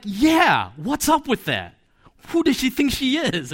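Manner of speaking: a light mock of his defiant younger self; rebellious kid. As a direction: scoff like a cheeky schoolboy. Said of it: "I began to think like that".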